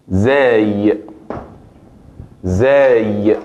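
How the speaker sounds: slow demo of zay, buzzing z; Arabic letter zay. Shown as a demonstration. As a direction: say zay with a voiced z. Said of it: "la letra". (pronunciación árabe de zay, con z sonora)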